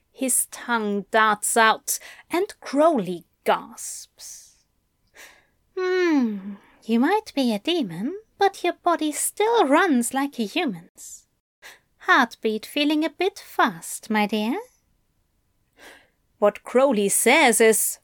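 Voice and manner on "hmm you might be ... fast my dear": higher, fondly amused lover; Aziraphale. Acted. Angel teases demon about his heartbeat, fondly amused. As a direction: tease him gently, voice lifted.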